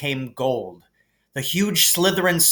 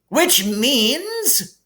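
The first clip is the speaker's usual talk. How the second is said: calling over applause; 12 semitones higher than usual. Acted. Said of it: "Dumbledore called over the storm".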